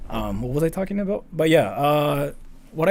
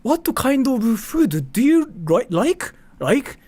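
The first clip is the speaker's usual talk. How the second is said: high, slow, sing-song repeated like; manager's high, slow question to the coworker; imitating the manager; 10 semitones higher, 4 dB louder, slower than usual. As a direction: draw out the question like the fussy manager. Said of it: "She actually sounded like".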